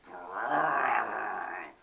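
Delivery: long, loud slow growl; tiger growl. Acted. Narrator imitating the tigers, loud growl. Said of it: "horrible noise".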